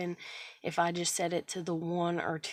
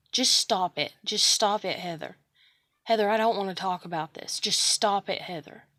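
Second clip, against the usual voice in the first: angry scolding; 7 dB louder than usual. Retold or imitated. Someone now scolding her emotions.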